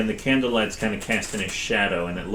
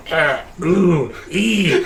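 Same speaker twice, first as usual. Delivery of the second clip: higher, louder, put-on hesitant ooh and ee sounds; fumbling noises; 8 semitones higher, 6 dB louder, much slower than usual. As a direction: flounder for a sound as if caught out. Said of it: "And he was like".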